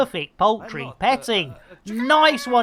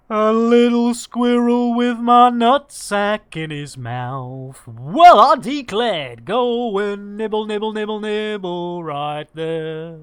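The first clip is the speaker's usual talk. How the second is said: squirrel skit character; 5 dB louder than usual. Acted.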